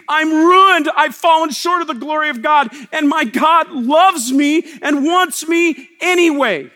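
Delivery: loud, raw cry of being ruined; anguished confession. Acted. Preacher voicing a sinner crying out. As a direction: confess it out loud, broken open.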